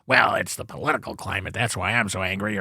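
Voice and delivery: a mock moan about the world; gloomy gus. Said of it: "look how many gloomy gusses".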